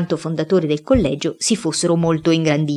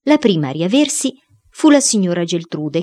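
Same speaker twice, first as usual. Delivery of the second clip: usual speaking voice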